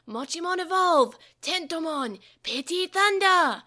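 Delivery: loud, high creature shout, slow on the attack; battle cry. A narrator's reading. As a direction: announce the attack with force. Digimon calling evolution and attack.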